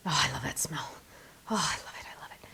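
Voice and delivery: soft unvoiced whisper of pleasure; delighted whisper. Real, not acted. Crafter sniffing a new stamp, delighted whisper. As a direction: savour the smell in a whisper.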